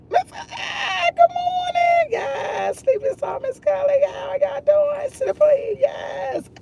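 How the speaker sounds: very high, excited calling out of names; high-pitched call to the chat; sing-song greeting. Real, not acted. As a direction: squeal hello to each viewer by name. High squeaky greeting to viewers.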